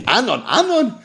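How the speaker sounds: high-pitched mock imitation, half laughing; mimicking how he talks. Aggrieved rabbi complains to a colleague about how he's addressed, mimicking high. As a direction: parrot the name back in a high voice. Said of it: "You see the way Rav Huna talks".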